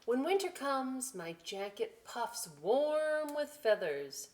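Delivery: put-on slow, lively read-aloud to children; storybook voice, slow and bright; story reading. A narrator's reading. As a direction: savour each line for the kids. Reads picture book aloud.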